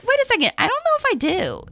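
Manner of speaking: high mock realization; sudden self-doubt. Inner voice quoted, sudden doubt.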